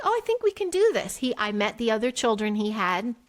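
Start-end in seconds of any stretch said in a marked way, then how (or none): 0.0-1.2 high, lifted voice of retold optimism; brightly hopeful self-quote, pitched up; hopeful realization. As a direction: act out talking yourself into hope. Retells her past hopefulness.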